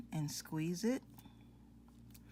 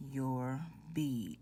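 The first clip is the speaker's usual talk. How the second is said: whispering; 4 semitones lower than usual. Real, not acted. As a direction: whisper it to yourself. Adding bead, drops to whisper.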